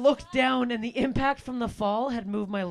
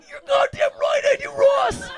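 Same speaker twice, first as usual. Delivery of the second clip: much higher, loud eager yell; excited shout; 4 dB louder than usual. Retold or imitated. Comic to Ross about tubing, a high eager shout. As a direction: yell it with glee. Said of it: "I'm like".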